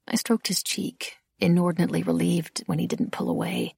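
plain reading voice

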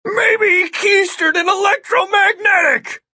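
loud, very high-pitched shouted guess; exasperated cry. A narrator's reading. Flustered agent guesses at the trick, loud high cry. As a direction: cry out the desperate theory. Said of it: "Agent Miller cried".

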